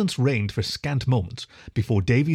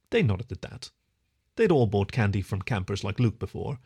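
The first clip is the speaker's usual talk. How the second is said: usual speaking voice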